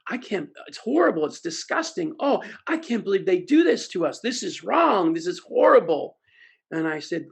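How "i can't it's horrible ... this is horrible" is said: lightly hammed-up disgust, louder at the end; mock outrage. Salespeople react to buyers stealing, mock outrage. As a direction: play the offended audience. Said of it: "And they go".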